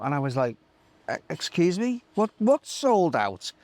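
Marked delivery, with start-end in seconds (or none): 1.1-3.5 incredulous. Retold or imitated. Shocked reply to Tim.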